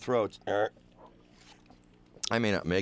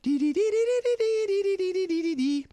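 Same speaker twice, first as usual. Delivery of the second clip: very high sung dee-dee chime; high falsetto celestial chime; high twinkling dee sound. Acted. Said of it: "I just do the voice thing".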